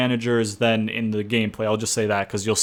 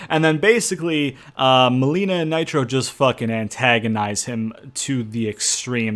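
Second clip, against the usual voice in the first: ordinary talk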